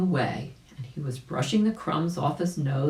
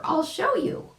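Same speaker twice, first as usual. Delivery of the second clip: much higher chirpy bird voice; catbird voice. Acted. Catbird to the rabbit, a high chirpy boast. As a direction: squeak it like a little bird.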